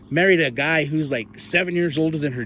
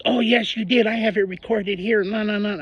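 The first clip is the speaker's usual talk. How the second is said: mocking the lady; 7 semitones higher than usual. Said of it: "stands up and she's".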